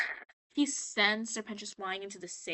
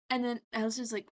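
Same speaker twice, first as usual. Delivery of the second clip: ordinary talk, nothing marked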